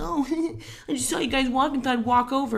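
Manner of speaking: put-on chirpy neighbour kid. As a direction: play the clueless kid all breezy. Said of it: "and he's like".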